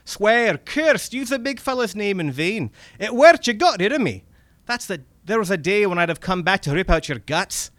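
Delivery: high, loud, exasperated Scottish rant; Scottish accent. Acted. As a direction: spell it out impatiently as a Scotsman. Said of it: "my bad Scottish accent comes in now".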